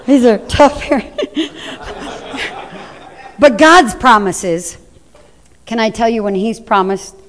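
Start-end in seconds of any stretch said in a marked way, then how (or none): 0.0-1.2 laughing through the words; amused, teasing laugh. Real, not acted. Laughing at congregation answer. 1.3-2.8 soft breathy chuckle; quiet laugh. Real, not acted.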